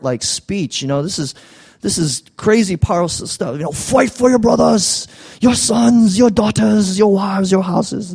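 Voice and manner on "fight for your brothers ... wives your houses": hammed-up Scottish rallying cry, high and slow; horrible Braveheart accent. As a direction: ham up a bad Scottish accent like a Braveheart speech. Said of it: "That was the horrible accent".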